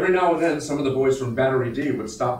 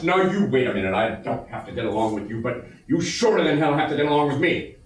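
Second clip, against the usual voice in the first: raised, hard-edged voice laying down the law; stern dressing-down; 5 semitones higher than usual. Acted. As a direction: read the riot act to rowdy men. Captain dresses down rowdy battery.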